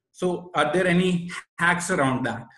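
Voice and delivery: ordinary talk, nothing marked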